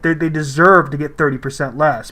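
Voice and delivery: ordinary delivery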